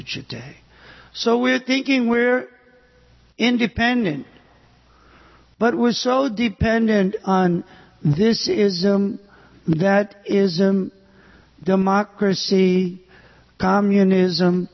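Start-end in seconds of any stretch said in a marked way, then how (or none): none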